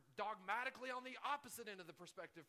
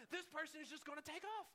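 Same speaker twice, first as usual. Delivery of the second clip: much higher, fed-up complaint; exasperated thought; 7 semitones higher than usual. Retold or imitated. Stuck driver complaining about the SUV, high and fed up. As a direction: whine that he's about to bolt. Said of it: "But I was like".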